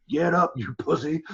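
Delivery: slow, drawn-out Southern referee bark; a lightly done Southern drawl, slow and a bit loud; Southern referee yell. Retold or imitated. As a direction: imitate the old Southern ref yelling at a fighter who sat down. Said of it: "'cause he talks all Southern".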